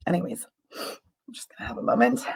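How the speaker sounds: quiet, choked-up aside; tearful. Real, not acted. Healer moved to tears by her vision, speaking softly and tearfully. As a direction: hold back tears as you speak. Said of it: "I have tears in my eyes".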